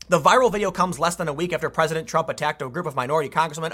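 usual speaking voice

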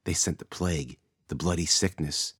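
a frightened woman murmuring her suspicion of the stranger; nervous. A narrator's reading. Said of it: "Her eyes shook nervously".

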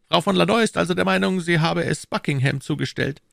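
high-pitched, insistent questioner; the Cardinal. A narrator's reading. (hoher, fragender Kardinal)

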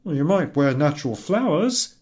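pleading with his wife to be glad; husband's voice. Acted.

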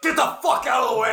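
high-pitched shouted order to clear out; impatient shout. Acted. Player acts out pushing past crew.

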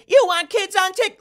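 loud, very high shrieking interruption; Nikki Haley, shrill. Retold or imitated. Host mimics candidate interrupting, shrill mock yell. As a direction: imitate a shrill candidate cutting in. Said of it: "she would raise her voice when interrupting".

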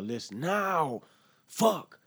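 the anger breaks out on the last word; angry outburst. Real, not acted. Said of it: "pissed off meter is rising".

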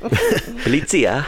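put-on slow drawn-out Italian accent. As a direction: say Polizia in a stretched Italian accent, ending on a. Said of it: "I can't say it in my head without an Italian accent".

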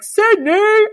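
high, loud yelled name; mock yell. Podcaster re-imitating [name]'s cry, loud mock yell. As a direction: shout it out like a pop star on stage. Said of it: "I'm like, blank space from the nineteen eighty-nine World Tour".

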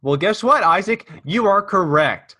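high, loud game show reveal; excited. Real, not acted. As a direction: announce it like a game show host. Host announces correct answer.

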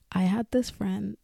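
ordinary delivery

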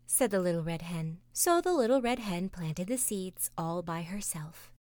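ordinary talk, nothing marked

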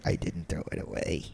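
low, near-whispered sinister reveal; sinister smile. Retold or imitated. Friend turns to back seat with smuggled drugs, sinister hush. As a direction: say it very softly through a creepy smile. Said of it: "Damien from, like, Omen, like, smile".

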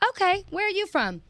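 high-pitched challenge to a heckler; imitated comedian. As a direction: mimic her taking on the heckler, up high. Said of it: "and she goes".

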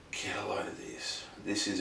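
whisper. Real, not acted. Aside before revealing the letter.

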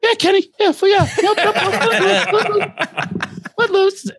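high, breathless stream of eager yeps; nervous high yes-man. Retold or imitated. Nervous self agreeing to sing.

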